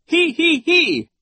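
very high, slow, loud hee-hee-hee; giggling. A narrator's reading. Nero shakes his pigtails in laughter, high giggle. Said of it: "Nero stopped giggling".

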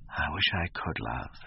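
slow, low and soft, full of pathos; deep pathos. A narrator's reading. Young man confessing to his friend he cannot love, with deep pathos. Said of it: "with a deep note of pathos in his voice".

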